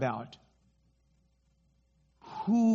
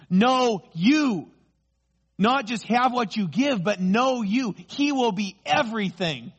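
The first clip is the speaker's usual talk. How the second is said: higher and louder, driving each point home; preaching at full force; 6 semitones higher, 4 dB louder than usual. Real, not acted. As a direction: build to a fervent climax.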